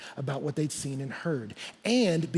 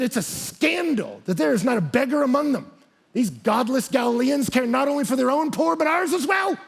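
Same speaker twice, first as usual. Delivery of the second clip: acted outrage, high and rising to a shout; mad emperor; 7 semitones higher, 3 dB louder than usual. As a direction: rage like an exasperated emperor. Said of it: "got so mad".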